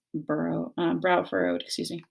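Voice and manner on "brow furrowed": ordinary delivery, nothing marked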